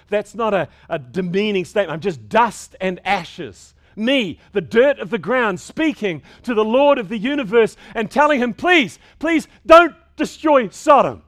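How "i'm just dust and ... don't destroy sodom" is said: pleading Abraham, rising higher and louder to a cry of please; voicing Abraham. Preacher voicing Abraham begging the Lord, building to a loud plea. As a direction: beg like a humbled man before God. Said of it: "he says".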